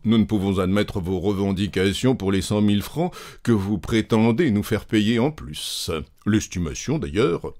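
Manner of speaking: a stiff insurance director addressing gentlemen; formal director. Acted. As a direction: lay down the company's position.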